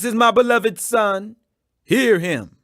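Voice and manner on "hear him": usual speaking voice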